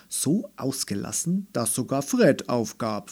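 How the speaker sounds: plain reading voice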